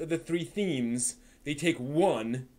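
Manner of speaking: each word drawn out and weighted, slow; slow emphatic complaint. Real, not acted. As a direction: spell out the grievance slowly, word by word. Complaining about film adaptation.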